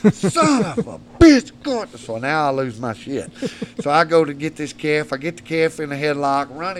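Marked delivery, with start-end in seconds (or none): 0.1-1.9 drawn-out, high cursing yell; retold outburst. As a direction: re-enact cursing loudly in pain, stretching it out. Retelling his angry outburst when kicked.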